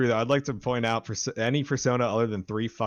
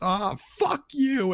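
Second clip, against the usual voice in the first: slow, much higher mock outburst; imitating the loudest yell; 12 semitones higher, 3 dB louder, slower than usual. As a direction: stretch out a raging cry at the game. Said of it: "the loudest".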